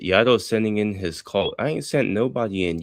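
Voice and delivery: ordinary delivery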